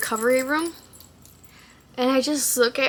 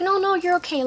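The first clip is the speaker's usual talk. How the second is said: reassuring lady; 7 semitones higher than usual. Retold or imitated.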